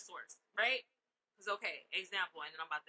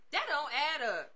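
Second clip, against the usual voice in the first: ordinary talk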